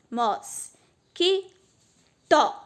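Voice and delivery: Mos, Que, to said one at a time, the last two punched; syllable by syllable. Shown as a demonstration. Teacher finding the final syllable, each syllable said separately. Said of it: "que é a última sílaba".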